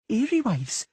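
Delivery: put-on high, shaking girl's voice; a frightened girl asking; trembling voice. A narrator's reading. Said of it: "said Ciri in a trembling voice".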